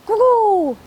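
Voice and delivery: high, loud cheer; excited cheer. Real, not acted. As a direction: cheer it out with hype. Hyping up the giveaway draw.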